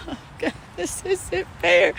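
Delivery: high, slow, hammed-up poor-me whine; mock self-pity whine. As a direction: whine it like a sulky child feeling sorry for herself. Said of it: "my little woes me moments".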